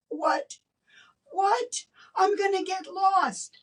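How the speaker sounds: high, incredulous what what; shocked she has to drive so far; startled disbelief. Retold or imitated. Woman to the Holy Spirit, high incredulous disbelief.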